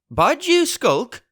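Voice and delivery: high, slow contemptuous cry; angry cry. Acted. Pugh sneers at the frightened pirate, angry. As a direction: sneer the word back. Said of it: "cried Pugh".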